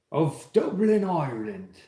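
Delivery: put-on shaky-footed Irish accent, pitch starting high and falling; playful, doubtful Irish accent; attempted Irish accent. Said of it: "was that an Irish accent or not".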